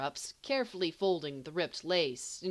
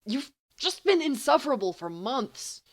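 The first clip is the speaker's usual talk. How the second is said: higher, louder, leaning on been; firm, louder repetition; emphatic repeat; 9 semitones higher, 7 dB louder than usual. Acted. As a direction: insist on it once more. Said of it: "Remus repeats".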